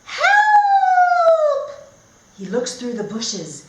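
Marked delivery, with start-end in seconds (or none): 0.0-1.7 high, loud cry for help; shout for help. Acted. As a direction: yell it like a scared child. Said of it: "he hears a shout".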